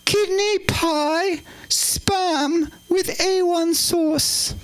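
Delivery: high, very slow royal proclamation; high-pitched, stately royal impression; the Queen. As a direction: draw each dish out in a high regal voice. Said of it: "do it in the Queen's voice".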